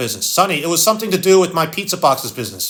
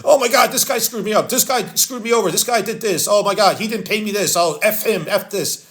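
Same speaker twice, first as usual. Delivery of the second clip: higher-pitched, worked-up grievance, easing off by the end; mock upset complaining; 4 semitones higher than usual. Young businessman griping about being screwed over, mock upset. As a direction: act out an emotional businessman's rant.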